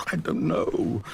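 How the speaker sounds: unvoiced hopeless whisper. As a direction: breathe it out, defeated. Narrator lost in despair.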